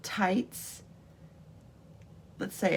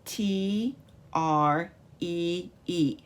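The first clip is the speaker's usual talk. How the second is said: T R E E very slowly, letter by letter; spelling out; much slower than usual. Shown as a demonstration. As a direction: leave space after each letter of T R E E. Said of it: "Let's spell it out".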